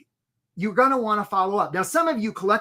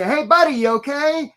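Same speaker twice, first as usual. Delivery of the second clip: higher, louder mock call to a stranger; 7 semitones higher, 5 dB louder than usual. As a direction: call across the water to check on someone. Calling to someone face down in water.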